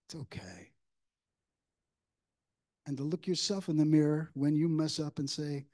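ordinary talk, nothing marked

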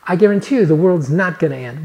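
usual speaking voice

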